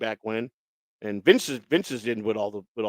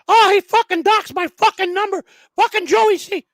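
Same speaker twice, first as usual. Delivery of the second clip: very high, loud squealed complaint; High Pitch Eric; 8 dB louder than usual. Retold or imitated. Irate man complaining about his number, loud high-pitched impersonation. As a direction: squeal it out in a piercingly high put-on voice. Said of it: "really good high pitch Eric impersonation".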